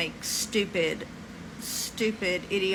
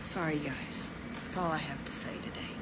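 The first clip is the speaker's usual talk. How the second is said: softer, half-whispered and defeated; resigned sign-off; 4 semitones lower, 7 dB quieter than usual. Real, not acted. Signing off to viewers, deflated and half-whispered. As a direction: let it trail off, giving up.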